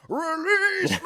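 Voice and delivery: high, strained scream of the lyric; imitating a rock singer's scream. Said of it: "And then he just screams".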